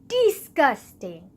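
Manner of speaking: loud, slow, drawn-out "Disgusting"; emphatic disgust. Real, not acted. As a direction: punch each syllable of "Disgusting", stretching it. Emphatic repeat for comic disgust.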